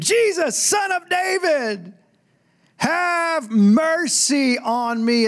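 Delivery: very high, drawn-out scream for mercy. Acted. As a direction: scream it slowly, pleading to be heard. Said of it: "He screamed".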